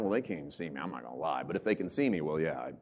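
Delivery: low, soft thinking to oneself; furtive aside. Acted. Churchgoer deciding whether to lie about Bible reading, furtive and low. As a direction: drop it low and quiet, weighing the lie. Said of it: "You just look around".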